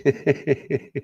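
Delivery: short run of quick chuckles. Real, not acted. Amused host reacting to a message, chuckling. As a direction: chuckle in rapid little bursts. Laughs after "Preach, [name], preach.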